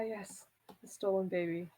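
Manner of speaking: normal talking voice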